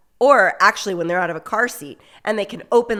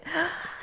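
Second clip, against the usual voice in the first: sharp excited gasp; delighted gasp; 9 semitones higher, 7 dB quieter than usual. Shown as a demonstration. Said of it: "You're like".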